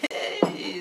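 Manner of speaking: ordinary delivery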